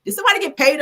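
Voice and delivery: high incredulous squeal; a squeaky what the fuck. Real, not acted. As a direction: shoot the voice up in disbelief. Outraged reaction, pitch way up.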